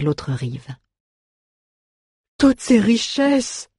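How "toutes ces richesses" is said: high, drawn-out dwarf wail; hiccuping lament. A narrator's reading. Dwarf leaving treasure behind, hiccuping lament. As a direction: wail slowly over lost riches. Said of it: "se plaignit le nain en hoquetant".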